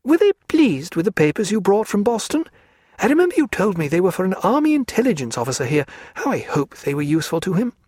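a spirited lady mocking her hostess; Diana's voice. A narrator's reading.

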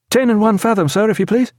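officer reporting to his captain; lightly voiced young officer. A narrator's reading. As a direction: report the speed smartly.

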